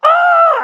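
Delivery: high, loud mock scream of excitement; the big thrilled shriek someone is waiting for; excited scream. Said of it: "she's waiting for the".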